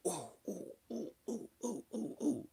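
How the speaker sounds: soft, hushed ooh of alarm; mock scared ooh. Kids watching sister get whooped, hushed mock ooh. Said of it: "and we like".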